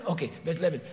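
usual speaking voice

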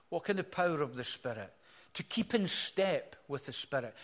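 usual speaking voice

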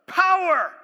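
loud, high shout of the word; full-force pulpit shout; preached cry. Real, not acted. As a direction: hit it like a thunderclap. Preacher's climactic one-word cry.

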